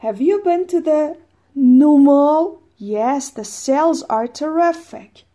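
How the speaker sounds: high, loud and slow textbook exchange; energetic model dialogue; lively dialogue reading. Shown as a demonstration. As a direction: act the shopping chat with energy. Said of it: "dialogue model".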